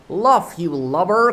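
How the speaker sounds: loud, high try at an Irish sailor's cry; Irish voice attempt. Smee shouting at his mate, mock Irish. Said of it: "cried an Irish voice that was Smee's".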